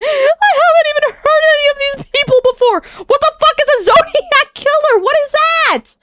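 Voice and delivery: very high, squealing disbelief; exasperated outburst. Real, not acted. Streamer baffled by killer names, exasperated squeal.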